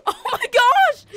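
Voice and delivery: very high, loud squeal of shock; shocked cry. Pretend. Driver who just ran over twenty people, shocked squeal. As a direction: shriek it in disbelief.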